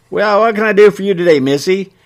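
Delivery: lightly voiced shopkeeper, high and quick; folksy shopkeeper. A narrator's reading. Shopkeeper asks customer what she needs, folksy and high. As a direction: pitch it up like a chipper storekeeper.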